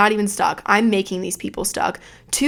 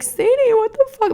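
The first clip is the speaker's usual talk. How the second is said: much higher, near-tearful outburst; upset mom; 14 semitones higher than usual. Retold or imitated. Mom upset after the water prank, high near-tearful outburst. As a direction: play the hurt mother about to cry. She was about to cry.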